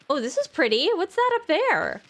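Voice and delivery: usual speaking voice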